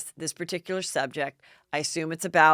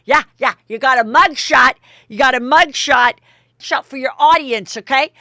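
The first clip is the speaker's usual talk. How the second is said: loud, higher mocking drunken taunt; 7 semitones higher, 10 dB louder than usual. Dorinda taunting Luann about her mugshot, loud drunken jeer. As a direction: jeer it like a tipsy bully. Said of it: "And she goes".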